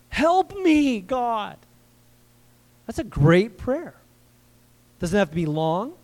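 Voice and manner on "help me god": high, pleading cry to God; desperate cry for help. Shown as a demonstration. Preacher showing a prayer that is just a cry, pleading. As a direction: cry it out like a man at the end. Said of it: "Just cry".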